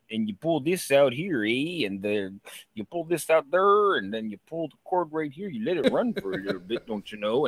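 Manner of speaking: put-on lilting thick Canadian accent. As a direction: imitate a folksy Canadian boatman's accent. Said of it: "what we would consider, like, Canadian accent".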